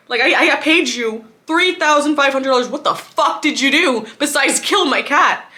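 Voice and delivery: loud, furious accusation; angry rant at the vet. Retold or imitated. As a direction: yell it at the vet who failed. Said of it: "I'm blaming them".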